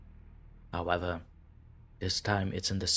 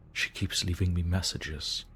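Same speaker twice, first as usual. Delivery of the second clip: ordinary delivery, nothing marked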